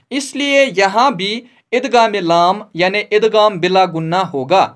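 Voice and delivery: usual speaking voice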